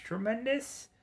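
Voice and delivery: mock disbelief. Mocking the game's word.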